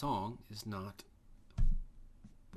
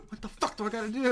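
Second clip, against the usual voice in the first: frustrated yell; 12 semitones higher, 9 dB louder than usual. Retold or imitated. Acted frustrated time traveler.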